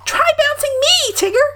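tiny, high, eager Roo; bursting with eagerness; eagerly. A narrator's reading. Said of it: "said Roo eagerly".

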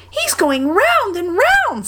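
very high, drawn-out squeal; much impressed. A narrator's reading. Roo watches Eeyore in the eddy, impressed squeak. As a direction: marvel slowly in a tiny squeaky voice. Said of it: "much impressed".

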